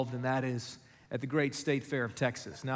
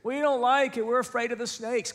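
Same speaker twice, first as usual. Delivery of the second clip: put-on high, loud kid whine; imitated child's high-pitched complaint; complaining little boys; 9 semitones higher, 3 dB louder than usual. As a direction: protest like a scared kid to mom. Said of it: "We'd say".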